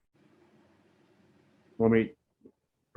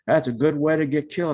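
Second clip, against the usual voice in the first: angry cussing; 6 semitones higher than usual. Retold or imitated. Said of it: "kept cussing and going".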